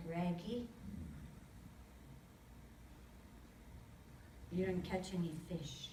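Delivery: lightly voiced elder delivering quiet news; a hint of the elder's voice. Elder telling Reggie he caught no fish, quietly grave. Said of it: "With that voice".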